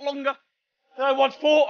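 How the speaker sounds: winded after shouting; out of breath. Real, not acted. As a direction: talk while catching breath. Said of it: "I'm out of breath".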